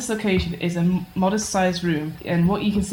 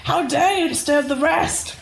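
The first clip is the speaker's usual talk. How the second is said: hammed-up lich shriek; 11 semitones higher, slower than usual. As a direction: wail it like a flustered lich. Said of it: "flails at you like".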